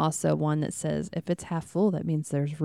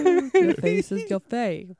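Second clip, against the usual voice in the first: slow, high, louder gangster line; hammed-up tough guy; 7 semitones higher, 5 dB louder, slower than usual. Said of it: "in a gangster voice".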